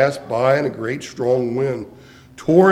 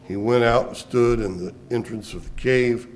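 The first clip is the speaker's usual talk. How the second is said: ordinary talk, nothing marked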